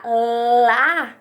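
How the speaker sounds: "lá" loud, with the L held; fonemas juntados. Shown as a demonstration. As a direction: slide from a long L into "lá". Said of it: "junto dos dois fonemas". ("lá" com o L prolongado)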